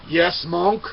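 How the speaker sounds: a warrior answering sharply; proud reply. Acted. Samurai answers the monk.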